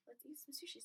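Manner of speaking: softly whispered cheerful line. Retold or imitated. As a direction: breathe it out quietly. Retold family at sushi.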